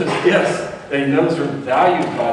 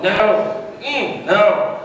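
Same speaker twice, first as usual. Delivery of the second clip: put-on high childish refusal; imitating a fussy kid; 7 semitones higher, 3 dB louder, much slower than usual. Kid refusing a new food from the parent, high childish no. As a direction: refuse the food like a stubborn toddler. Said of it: "they're going".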